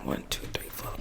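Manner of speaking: whispered count. Pretend. Jesus quietly counting the disciples before the serious news, whispered. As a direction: whisper the headcount under your breath.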